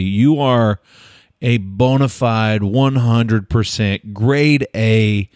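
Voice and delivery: very slow, deliberate build-up; drawn-out mock-solemn pronouncement; dramatic verdict. Real, not acted. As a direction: spell it out phrase by phrase, pause before the last word.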